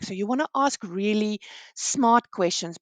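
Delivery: ordinary talk, nothing marked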